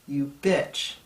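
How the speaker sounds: loud mock insult, punched out; playful insult, loud. Speaker addressing his younger self, loud teasing jab. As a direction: call your younger self out loudly. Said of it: "I would say two words".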